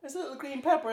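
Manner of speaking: can't get the line out for laughing; laughing through the quote. Real, not acted. Telling his friend about the jalapenos, laughing through it. Laughing while quoting girl.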